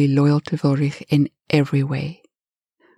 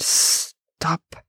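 unvoiced, hissed command; angry whispered hiss; 4 dB quieter than usual. A narrator's reading. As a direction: hiss it as a sharp order. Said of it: "Ulrich hissed".

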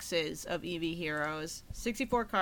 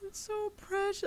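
gushing; 12 semitones higher, 7 dB quieter than usual. Real, not acted. Gushing over cute promo art.

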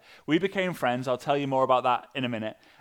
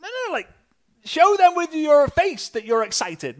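excited coaching; 12 semitones higher, 7 dB louder than usual. Retold or imitated. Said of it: "And I'd be like".

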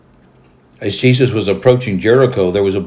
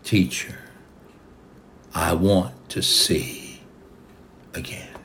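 simple, quiet request; 3 semitones lower, 6 dB quieter than usual. A narrator's reading. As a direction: say it simply, fading to almost nothing. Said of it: "he simply told him".

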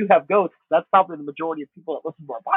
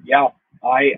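ordinary talk, nothing marked

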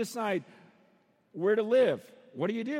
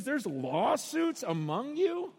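slow, incredulous question; drawn-out mock shock; sarcastic disbelief; 3 semitones higher, slower than usual. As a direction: stretch it out as if appalled. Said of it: "it's just like sarcasm after sarcasm".

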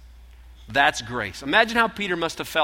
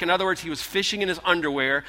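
normal talking voice

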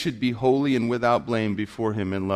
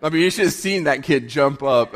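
ordinary talk, nothing marked

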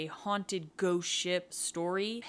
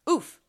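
oof; 10 semitones higher, 11 dB louder than usual. Acted. Bumps into someone.

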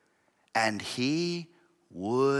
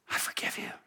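hushed, voiceless whisper; softly whispered absolution; whispered forgiveness; 9 dB quieter, faster than usual. Acted. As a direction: drop to a whisper to grant pardon. Servant's imagined forgiveness, after outburst.

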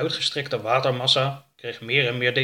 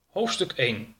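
usual speaking voice

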